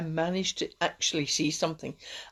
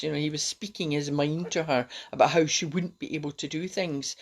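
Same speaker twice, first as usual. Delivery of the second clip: ordinary talk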